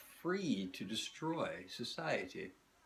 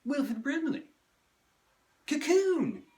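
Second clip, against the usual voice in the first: much higher, loud comic shout; shouted name; 11 dB louder than usual. Acted. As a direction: yell it out like a bad impression. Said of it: "working on a Wilford Brimley impersonation".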